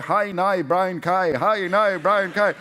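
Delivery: a hint of a Northern Irish accent, sing-song drill; lightly mock Northern Irish vocal warm-up. Mate practising his Northern Irish accent, lightly imitated. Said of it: "train his Northern Irish accent by going".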